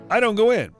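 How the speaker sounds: plain reading voice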